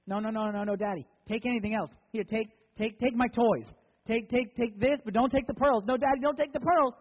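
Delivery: put-on high, loud child begging Daddy; frantic pleading. Little girl begging Dad to spare her pearls, frantic pleading. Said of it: "And she starts to cry".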